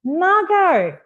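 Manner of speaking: ordinary talk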